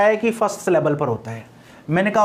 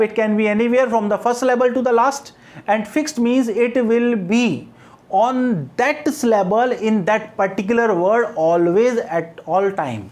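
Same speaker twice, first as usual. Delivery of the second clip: slow dictation; much slower than usual. Shown as a demonstration. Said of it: "dictating a definition".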